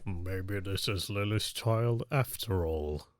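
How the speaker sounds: low, slow drawn-out impression; deep, quiet, deliberate character voice; Snape. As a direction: drop the voice low and draw it out slowly. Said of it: "and then Snape is like".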